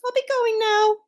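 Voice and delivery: very high little mouse voice; small, high-pitched and polite; meek mouse. A narrator's reading. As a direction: squeak it meekly. Mouse meekly agrees to leave.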